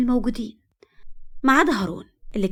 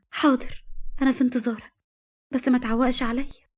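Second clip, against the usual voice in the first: put-on girlfriend; 4 semitones higher than usual. A narrator's reading. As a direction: ask him gently not to be late. Girlfriend voice on phone.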